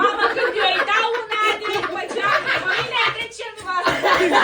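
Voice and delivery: high, loud mock complaint amid laughter; loud exasperation. Real, not acted. Playful complaint while everyone laughs.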